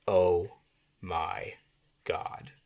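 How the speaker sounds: "Oh", "my", "God" as three separate sentences, dropping away; slow, accented. Shown as a demonstration. Writing teacher showing one-word sentences, slow and accented "Oh. My. God.". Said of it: "It's so slow. It's so accented".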